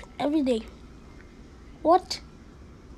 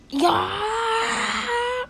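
long, high rising yell; pretend battle cry; 7 semitones higher than usual. Cat readying a super laser gun, battle cry. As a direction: draw it out as a rising war cry.

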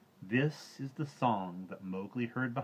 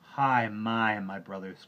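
louder, anguished cry; 3 semitones higher, 12 dB louder, slower than usual. A narrator's reading. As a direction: cry out to the brothers. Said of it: "cried Mowgli".

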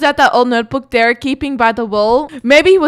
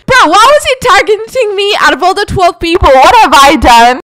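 normal talking voice

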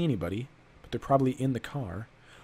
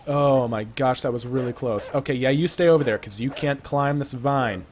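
ordinary talk

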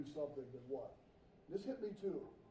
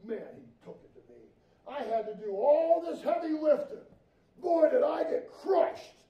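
hammed-up whining complaint, louder at the end; grumbling workers; 7 semitones higher, 10 dB louder than usual. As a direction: whine about the heavy work. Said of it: "But now they're grumbling".

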